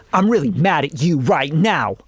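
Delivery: hammed-up mad line with swooping emphasis; unnatural mock anger. Shown as a demonstration. Coach shows the unconvincing way of being mad, exaggerated. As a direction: overplay the anger so nobody believes it. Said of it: "to 'em like this".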